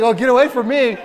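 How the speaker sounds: laughing through a squeamish brush-off; mock recoil, laughing. Congregant pulling away from fingers, laughing. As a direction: act the squirming woman, then laugh. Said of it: "She's like".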